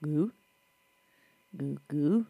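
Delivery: put-on soft, low baby cooing; baby babble. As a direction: coo like a happy baby. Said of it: "he wakes up and he goes".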